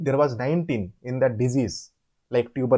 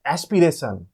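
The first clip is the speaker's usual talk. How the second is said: normal talking voice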